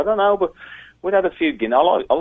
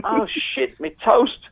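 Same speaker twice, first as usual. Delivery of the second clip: high mock-panicked yelp over burnt toast; startled yelp; much slower than usual. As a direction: squeak it out like you just smelled smoke. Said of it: "And I went".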